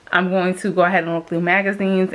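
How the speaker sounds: ordinary talk, nothing marked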